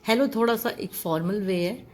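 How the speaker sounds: usual speaking voice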